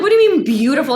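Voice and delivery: high, loud incredulous question; shocked disbelief. Retold or imitated. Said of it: "I was, like, kinda in shock".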